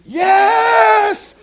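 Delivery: high, loud shouted answer; wife yelling back about the rattler; shrieked yes. Retold or imitated. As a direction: scream back in alarm.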